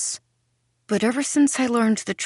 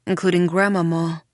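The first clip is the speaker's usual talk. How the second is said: usual speaking voice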